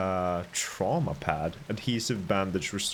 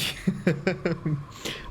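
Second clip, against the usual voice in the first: nervous laugh; 9 semitones higher than usual. Real, not acted. Laughing after a jump scare.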